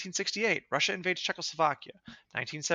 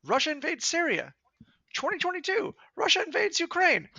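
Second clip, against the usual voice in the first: each invasion higher than the last, outraged; building outrage; 8 semitones higher than usual. Real, not acted. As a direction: list climbing higher and higher in pitch. Escalating list of invasions.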